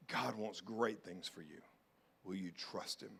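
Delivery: ordinary delivery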